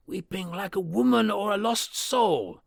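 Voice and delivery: high, altered voice, horror in it; a servant remembering something dreadful; haunted butler. Acted. Poole describing the weeping.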